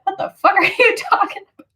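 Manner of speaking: high, disbelieving mom voice with a laugh; the mom baffled at the kids; incredulous mom. Retold or imitated. Imitating her mom reacting to the tree knock story, high and incredulous. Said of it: "my mom was like".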